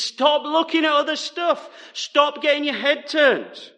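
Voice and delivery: lightly acted, high-pitched telling-off; scolding girlfriend. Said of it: "Our writer is that girlfriend".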